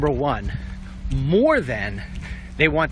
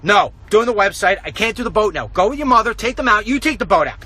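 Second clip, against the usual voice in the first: louder, brushing-off reply to his son; curt refusal; 6 semitones higher, 5 dB louder than usual. Retold or imitated. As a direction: snap back busy and impatient. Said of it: "I'm like".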